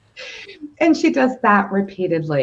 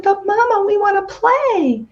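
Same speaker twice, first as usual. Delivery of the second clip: little sister mouse; 9 semitones higher than usual. Acted. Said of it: "And Sister Mouse said".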